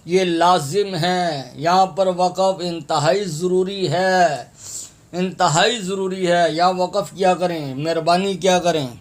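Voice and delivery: normal talking voice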